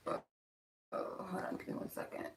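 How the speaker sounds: quick whispered aside off-mic. Real, not acted. Quick aside while fixing phone.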